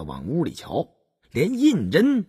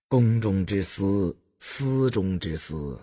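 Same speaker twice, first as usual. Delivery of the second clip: a hushed, slow court adviser warning; low and measured, keep it confidential; 7 semitones lower, 5 dB quieter than usual. A narrator's reading. Narrator voices a court adviser.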